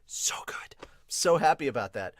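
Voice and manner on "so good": breathy whispered delight; real whispered happiness. Real, not acted. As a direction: hush it like a secret joy. Whispers after saying aloud.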